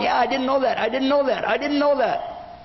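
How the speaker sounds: high-pitched mock concession; flustered admission. Acted man conceding point after point.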